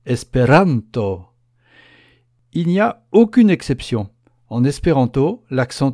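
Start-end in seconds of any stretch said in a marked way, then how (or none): 0.1-1.3 slow "Esperanto", RAN held long, TO let go reluctantly; exaggerated penultimate stress. Shown as a demonstration. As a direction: stretch RAN in "Esperanto", release the last syllable grudgingly. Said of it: "comme si l'on prononçait à regret la dernière syllabe".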